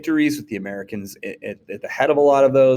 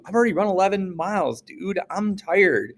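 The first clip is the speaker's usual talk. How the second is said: tired runner; 8 semitones higher than usual. Retold or imitated.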